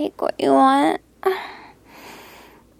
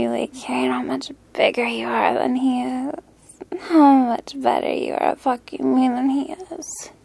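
whispering. Acted. Teasing whisper to lover.

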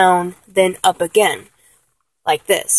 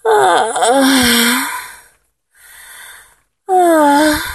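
high to low moans; 6 semitones higher, much slower than usual. Shown as a demonstration. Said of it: "a high moan to a lower tone".

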